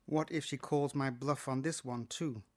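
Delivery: ordinary delivery, nothing marked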